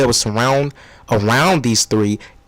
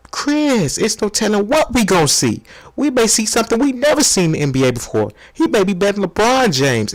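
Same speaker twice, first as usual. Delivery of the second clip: excited pitch; 7 semitones higher than usual. Acted. Hyped debate pitch.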